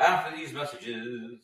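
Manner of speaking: TV announcer voice. Pretend. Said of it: "Do you remember that from Saturday morning cartoons?".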